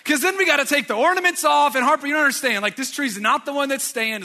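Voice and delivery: high, loud mock exasperation, easing slightly; frantic objection. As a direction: argue frantically with a small child. Acted frantic explaining to the child.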